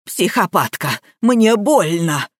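ordinary delivery

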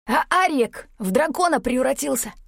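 high, excited creature voice; squealing Kiash. A narrator's reading. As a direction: squeal the astonishing news, pitched high. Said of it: "заверещал откуда-то сбоку Киаш". (верещащий голос русала)

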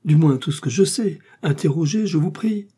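a hint of eager submission; eager prisoner Bonacieux. A narrator's reading. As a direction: beg politely to be questioned. Bonacieux pleads to be questioned.

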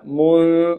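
من held as a long hum through the nose; hidden nasal N. Shown as a demonstration. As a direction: hold the concealed N of من, mostly nasal. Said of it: "وقلنا في الإخفاء".

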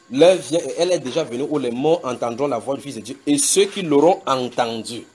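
loud, emphatic proclaiming of the verse; preaching scripture. Acted. Verse repeated with force.

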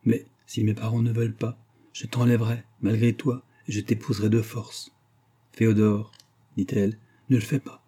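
ordinary delivery, nothing marked

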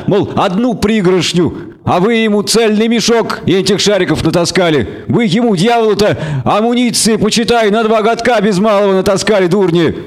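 a hint of a folksy village man; villager voice.

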